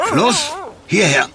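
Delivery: high call to the dog. Acted. The gardener calls the dog away from the berries, high. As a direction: call the dog over firmly.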